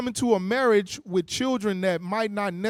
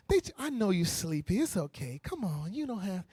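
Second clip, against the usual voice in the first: put-on soothing baby; 4 semitones lower, 8 dB quieter than usual. Parent soothing sleepy crying baby.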